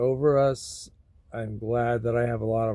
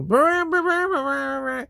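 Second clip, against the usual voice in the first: high, loud trumpet "bah bah"; imitated trumpet blare; 13 semitones higher, 5 dB louder than usual. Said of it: "guys with trumpets going around that place".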